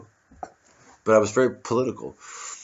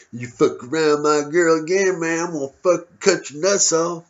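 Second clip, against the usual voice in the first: mock hometown tough guy, higher and louder; tough guy threat; 5 semitones higher, 3 dB louder than usual. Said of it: "people who talk like that".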